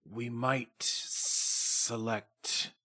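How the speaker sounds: low, slow, hushed and drawn-out; slow creature voice. Acted. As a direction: speak like a huge old beast weighing every word. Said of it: "he says".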